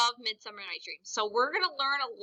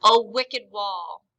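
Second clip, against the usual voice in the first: angry; 7 dB louder, much slower than usual. Shown as a demonstration. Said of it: "is angry".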